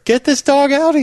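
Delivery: high, worked-up order to get out; mock-outraged bartender. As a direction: throw the customer out, pitch soaring. Bartender repeats order, escalating.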